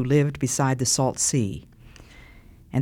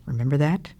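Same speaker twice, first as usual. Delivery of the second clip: ordinary delivery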